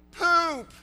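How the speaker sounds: high shrieking yell of disgust. Retold or imitated. Boy in the mud yelling to his friends, loud and high. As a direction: shout it, high and loud, grossed out. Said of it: "And he began yelling".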